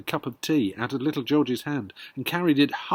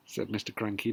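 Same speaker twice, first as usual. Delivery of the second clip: ordinary delivery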